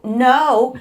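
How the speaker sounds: loud, high retold cry of refusal; distraught protest. Patient hears the appointment is a month away, distraught. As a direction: blurt it out loud and high, upset. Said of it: "then I just started crying".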